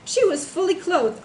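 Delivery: shrill-edged outrage pitched high above the narration. Acted. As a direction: jump way up in pitch and lean on each word. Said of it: "Niobe's voice raised an octave".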